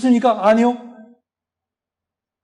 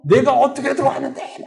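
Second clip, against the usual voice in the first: higher, strained wail without much volume; raving demon; 4 semitones higher, 3 dB quieter than usual. Retold or imitated. Demon in the woman resisting the exorcist, raving. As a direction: act out a demon's frantic protest. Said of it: "막 이러면서 막 난리를 치는 거야".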